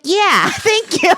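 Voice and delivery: put-on high, laughing Michigan accent, mom's thanks; a daughter imitating her mom's sweet Michigan thank-you. Laughing Michigan accent thanking her daughter. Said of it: "In her sweet little Michigan accent".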